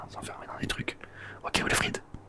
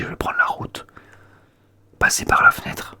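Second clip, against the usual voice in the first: loud, high whispered disbelief; whispered disbelief getting louder; rising incredulous whisper; 11 dB louder than usual. Acted. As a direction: build up to mock disbelief. Mocking friend's plan.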